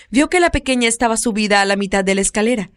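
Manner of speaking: ordinary delivery, nothing marked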